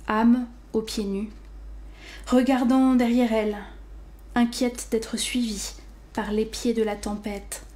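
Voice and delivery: sober poetry reading with long pauses; understated, measured recital, fading at the end; poem recitation. Acted. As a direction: let each isolated word stand alone in silence. Recites the collection's opening poem.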